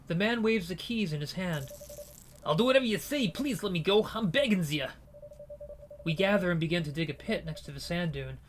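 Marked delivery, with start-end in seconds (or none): 2.4-4.9 high, desperate begging; a thief pleading with the gangster; begging prisoner. Acted. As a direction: plead for your life, voice raised.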